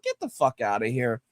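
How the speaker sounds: low, quiet, cold brush-off; dismissive growl. Retold or imitated. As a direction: send him away with contempt. Uncle Angry dismissal, deep.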